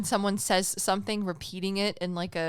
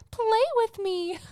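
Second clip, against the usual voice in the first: much higher chirpy toy voice on the quote; talking toy voice; 5 dB louder, slower than usual. Retold or imitated. Husband retells toy talking at night: high toy voice. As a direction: mimic the creepy toy that answers every bump. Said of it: "I hear".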